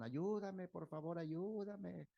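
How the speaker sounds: high, desperate cry for help; pleading scream. Retold or imitated. Fake captive crying for help over the phone, high and pleading. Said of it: "Se veía que gritaban".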